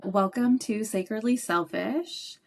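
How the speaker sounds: usual speaking voice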